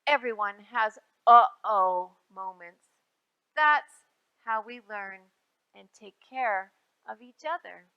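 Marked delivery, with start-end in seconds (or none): none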